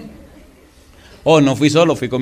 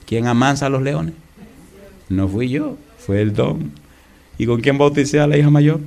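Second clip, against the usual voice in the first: usual speaking voice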